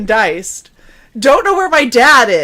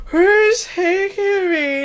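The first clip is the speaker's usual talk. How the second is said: drowsy confusion; 9 semitones higher, 3 dB quieter, much slower than usual. Retold or imitated. Drugged confusion retold.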